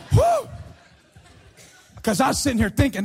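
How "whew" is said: high, loud exhale of relief; exaggerated "phew" of feigned relief; mock relieved whew. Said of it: "I was really nervous about that".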